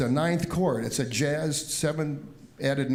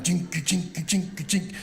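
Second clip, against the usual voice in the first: imitated guitar strumming; 4 semitones higher than usual.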